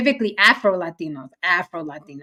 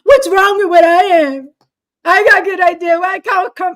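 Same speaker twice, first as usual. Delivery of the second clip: higher, louder put-on accent, pleading; mock immigrant voice; 7 semitones higher, 5 dB louder than usual. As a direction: whine it like a hurt relative.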